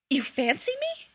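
can't believe his ears, voice jumping up; incredulous, high-pitched. A narrator's reading. Remus, stunned by Sirius's confession, asks in high disbelief. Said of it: "incredulous and high-pitched".

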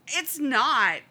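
loud, high stubborn denial; boy refusing to believe his brother; insistent. A narrator's reading. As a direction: dig in and insist. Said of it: "Jay insists".

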